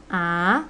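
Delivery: "a" sweeping upward; second tone. Shown as a demonstration. Teacher modelling for repetition, rising "a". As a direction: raise the end of "a" like asking. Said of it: "Second tone".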